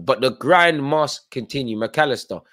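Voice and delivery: ordinary talk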